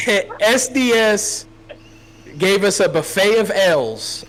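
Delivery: high, slow, drawn-out misspoken line; slip of the tongue. Real, not acted. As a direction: say the wrong word slowly before catching it. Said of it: "oh, blah, sorry".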